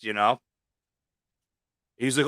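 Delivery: ordinary talk, nothing marked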